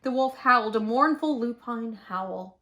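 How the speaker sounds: usual speaking voice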